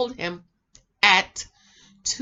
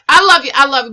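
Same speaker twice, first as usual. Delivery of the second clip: loud affectionate; 4 semitones higher, 14 dB louder, faster than usual. Real, not acted. Affectionate outburst.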